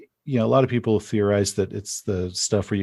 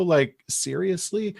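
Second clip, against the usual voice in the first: incredulous; 8 semitones higher than usual. Real, not acted. Ranting about slow streaming shows.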